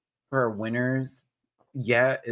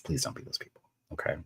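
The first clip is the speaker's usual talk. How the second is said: whispering; 5 semitones lower, 9 dB quieter, faster than usual. Real, not acted. Pleading aside to viewers.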